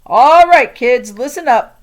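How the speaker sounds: teacher calling the class to order, loud. Acted. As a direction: grab the room's attention.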